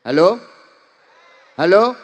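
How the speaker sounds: loud puzzled hello; host baffled by the odd greeting; confused host. Retold or imitated. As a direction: call out bewildered. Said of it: "Bingung tuan rumahnya".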